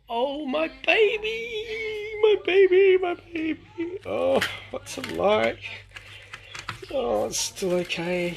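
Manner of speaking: high, slow, doting coo. Real, not acted. Cooing at a tiny pet creature.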